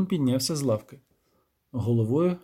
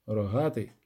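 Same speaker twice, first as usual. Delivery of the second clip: plain reading voice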